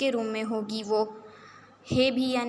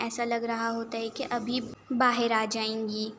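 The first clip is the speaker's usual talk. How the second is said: ordinary delivery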